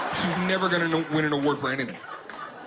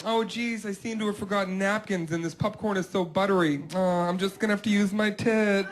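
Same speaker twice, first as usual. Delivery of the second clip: teasing fake innocence; 6 semitones higher than usual. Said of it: "toying around with me".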